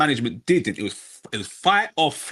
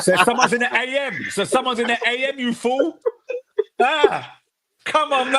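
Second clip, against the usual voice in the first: laughing; 9 semitones higher than usual. Real, not acted. Mocking friend, laughing.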